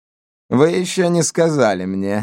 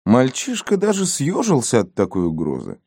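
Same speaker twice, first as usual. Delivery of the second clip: usual speaking voice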